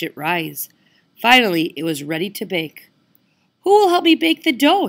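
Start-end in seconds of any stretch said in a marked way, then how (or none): 3.6-4.9 high, louder hen voice; little red hen voice. Acted. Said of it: "she asked".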